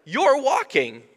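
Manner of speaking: marvelling at a healed man, high and loud; amazed. Retold or imitated. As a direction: gasp it out in astonishment. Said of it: "I'm like".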